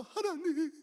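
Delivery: high, slow, hammed-up wail; mock crying. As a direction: wail a tearful complaint up to heaven. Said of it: "울고 막 그러니까".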